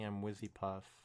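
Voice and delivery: ordinary talk, nothing marked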